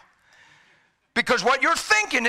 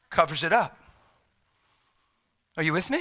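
ordinary talk

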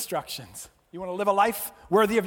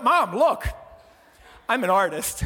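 put-on proud kid; 3 semitones higher, 7 dB louder than usual.

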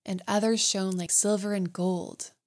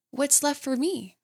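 put-on young mermaid; 7 semitones higher than usual. A narrator's reading. Mermaid pleads.

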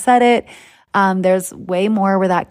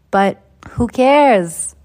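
Boston accent slipping out, pitched higher; playful Boston accent, higher voice; 7 semitones higher, slower than usual. Real, not acted. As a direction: let your Boston roots show through. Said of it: "That's my Boston accent coming out".

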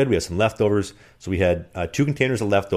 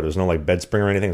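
usual speaking voice

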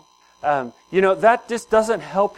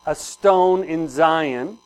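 slow scripture reading; 4 dB louder, much slower than usual. Acted. Pastor reading scripture aloud slowly.

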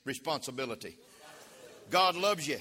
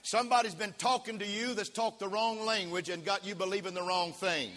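ordinary talk, nothing marked

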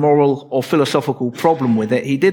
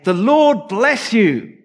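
high, slow, beaming greeting as Saul; cheerful greeting; 7 semitones higher, slower than usual. Acted. Saul pleased with himself greets Samuel, high and beaming. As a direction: greet him like you're proud of yourself. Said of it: "It's a very cheerful greeting".

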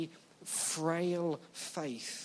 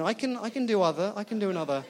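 ordinary delivery

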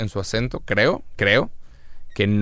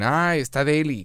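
put-on high, drawn-out girly gush; mock girly drawl; girls' voice; 4 semitones higher, slower than usual. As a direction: imitate a girl gushing, pitched up and slow. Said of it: "las chavas que dicen".

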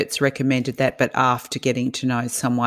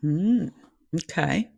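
unimpressed; 2 semitones higher, 6 dB quieter than usual. Real, not acted. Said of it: "You sound really unimpressed".

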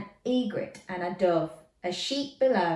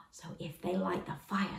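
usual speaking voice

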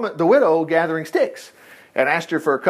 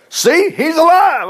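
high, loud, slow and triumphant; joyful announcement; 11 semitones higher, 5 dB louder, slower than usual. Acted. Elijah shows the mother her living son, joyful. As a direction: hold him up and proclaim it.

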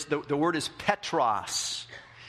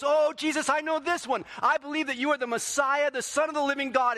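much higher, bright eager know-it-all; eager Peter; 11 semitones higher than usual. Retold or imitated. Peter eagerly answers Jesus, high and excited. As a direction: blurt it out like the keen student.

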